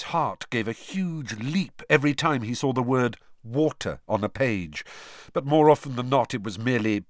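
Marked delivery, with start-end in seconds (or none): none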